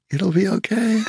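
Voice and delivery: lilting reassurance. Retold or imitated. Trucker waves from truck.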